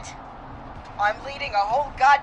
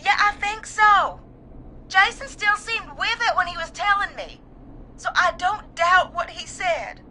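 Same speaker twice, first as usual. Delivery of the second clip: distraught; 7 semitones higher than usual. Acted. Caller assures host Jason was lucid, distraught. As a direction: insist through the distress. Caller insists on friend's account.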